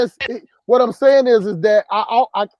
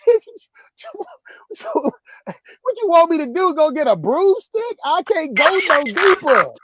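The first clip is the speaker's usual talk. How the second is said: higher, exasperated mock protest; incredulous retort; 7 semitones higher than usual. Answering her demand in bed, pitched-up exasperated protest. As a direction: toss it back at her in disbelief. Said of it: "I'm like".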